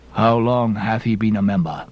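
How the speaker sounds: low, hollow pious elder; grave, low interrogation; stern examiner. A narrator's reading. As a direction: question him gravely, pitched low. Bildad examining the narrator.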